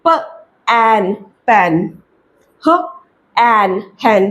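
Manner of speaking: each sound very slow before the whole word; slow phonics blending. Shown as a demonstration. Teacher blending बैड and पैन slowly for parents. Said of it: "ए के sound से पढ़ना है".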